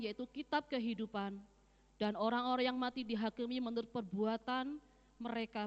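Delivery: solemn Bible recitation; scripture reading. Acted. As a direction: proclaim the verse to the church. Reads Bible verse aloud.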